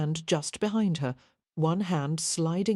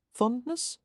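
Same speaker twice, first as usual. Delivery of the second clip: high rising mock surprise; teasing surprise; 7 semitones higher than usual. A narrator's reading. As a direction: raise an eyebrow with the word. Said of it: "Devon interrupted, his brows lifting".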